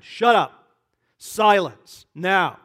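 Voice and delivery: loud, direct warrior command; stern command. Acted. Jesus silencing the evil spirit, a loud stern command. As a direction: silence the demon with authority. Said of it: "He said sternly".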